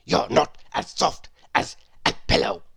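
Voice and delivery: whispery, grumbling pig voice; complaining pig. A narrator's reading. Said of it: "he complained".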